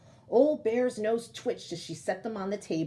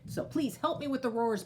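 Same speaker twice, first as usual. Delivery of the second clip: usual speaking voice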